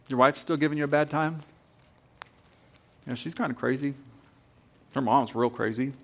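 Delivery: a friendly-sounding tempter leaning in close; sly whispering enemy. Pretend. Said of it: "he just sits there".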